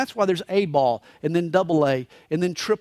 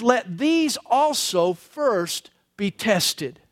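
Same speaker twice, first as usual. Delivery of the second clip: slow deliberate reading; much slower than usual. Shown as a demonstration. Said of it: "scripture read slowly".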